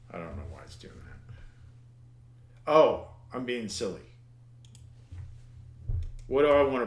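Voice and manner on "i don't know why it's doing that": barely voiced mutter under the breath, slowed; muttered to himself. Real, not acted. A programmer muttering at his screen, almost voiceless. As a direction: mumble it to yourself, almost no voice. Puzzled by the code.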